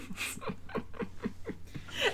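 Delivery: breathy high-pitched laugh; high wheezing laugh. Real, not acted. Joke about Harry's noticing.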